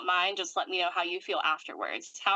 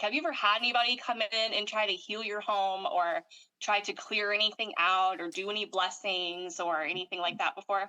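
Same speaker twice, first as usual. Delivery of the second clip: ordinary delivery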